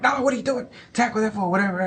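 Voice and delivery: high-pitched mock yelling at the game; crazed fan imitation. Uncle replaying his Super Bowl reaction, mock worked-up and high. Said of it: "like, real crazy about".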